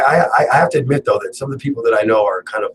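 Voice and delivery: ordinary talk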